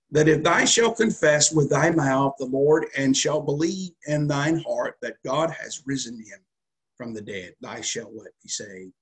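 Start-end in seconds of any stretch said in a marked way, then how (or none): 0.1-7.5 loud, ringing scripture proclamation; preaching at full force. Acted. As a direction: declaim the verse to the congregation.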